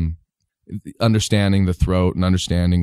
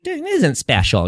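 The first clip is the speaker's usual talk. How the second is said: high, slow sketch-comedy character voice; Dana Carvey; 9 semitones higher, much slower than usual. Shown as a demonstration. Kid copying Saturday Night Live, high slow character impression. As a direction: draw it out high, mimicking a TV sketch. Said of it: "the Dana Carvey".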